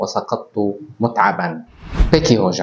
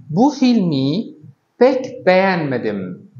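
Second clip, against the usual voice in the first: slow, a little higher, every word spaced; slow example sentence; 5 semitones higher, much slower than usual. Shown as a demonstration. Teacher modelling a Turkish sentence for students, slow and raised. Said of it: "كأن نقول مثلًا".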